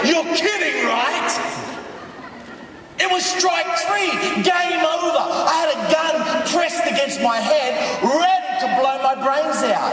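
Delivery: high, loud incredulous outburst; impassioned shout. Acted. What he wants to shout at well-meaning people, incredulous shout. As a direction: shout it out in punched phrases. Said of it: "I just wanna shout and say".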